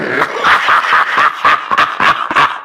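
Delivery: giggling laugh. Acted. Villain laughs at past fun.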